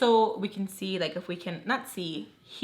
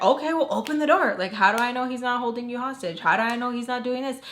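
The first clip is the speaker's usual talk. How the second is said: fast, high, louder worried demand; pissed; 3 semitones higher, 3 dB louder, faster than usual. Retold or imitated. Angry friend demanding roommate open door, fast and high. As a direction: press her hard and fast, worried.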